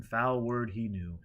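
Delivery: ordinary delivery, nothing marked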